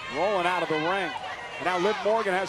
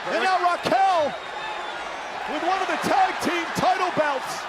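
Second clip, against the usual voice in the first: higher and louder commentator building; excited play-by-play; 11 semitones higher, 5 dB louder than usual. Real, not acted. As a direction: call the finish at full hype. Calling the finish excitedly.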